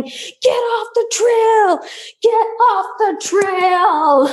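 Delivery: high, slow mock yell at a snake; retold yelling. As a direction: drag out a high yelling order. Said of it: "I'm just yelling".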